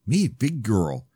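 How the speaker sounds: slow, lightly voiced small child; a hint of a toddler boasting. Little Lily boasting to Grandpa, slow toddler voice.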